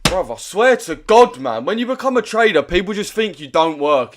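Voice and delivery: real irritation, voice pushed up; annoyed rant. Real, not acted. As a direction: vent loudly at the unfairness. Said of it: "I'm so annoyed right now".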